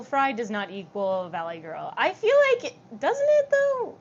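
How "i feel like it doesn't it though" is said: high-pitched protest. Real, not acted. Rising protest about vocal fry.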